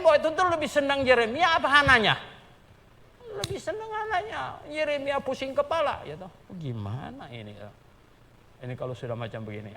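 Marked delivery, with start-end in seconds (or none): none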